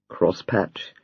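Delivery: low and soft, gently teasing; soft, provocative. A narrator's reading. Husband softly teases his sulking wife. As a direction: murmur it softly to tease her. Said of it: "adding in a soft, provocative voice".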